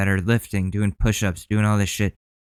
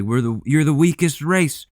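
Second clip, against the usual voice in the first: higher sneering imitation; mocking higher taunt; 6 semitones higher than usual. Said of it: "say shit like".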